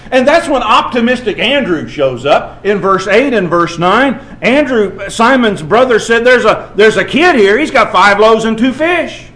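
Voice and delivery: loud, high preaching; preaching at full force. Acted. Preacher telling how optimistic Andrew found the boy, loud. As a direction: proclaim Andrew's find.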